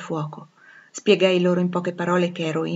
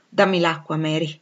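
ordinary delivery

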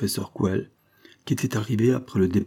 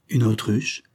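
plain reading voice

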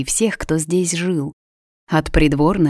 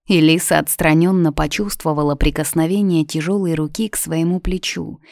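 ordinary delivery

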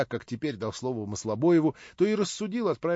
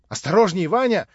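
louder, higher warning shout; calling out; 6 semitones higher, 8 dB louder than usual. A narrator's reading. Natasha calling after Vanya as he leaves, loud warning. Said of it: "закричала она мне вслед".

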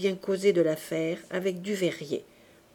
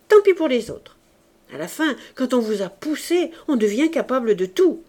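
loud, higher furious outburst; exploding anger; 4 semitones higher, 7 dB louder than usual. Acted. Mother erupts at her husband over the marriage, furious and loud. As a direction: let the built-up rage burst out. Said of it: "brusquement fit explosion".

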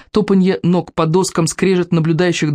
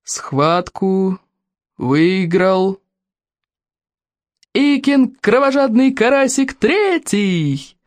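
slow, high ceremonial announcer call; grand entrance announcement; 4 semitones higher, much slower than usual. Acted. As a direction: proclaim the winner like an arena herald. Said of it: "старейший старейшина торжественно объявил".

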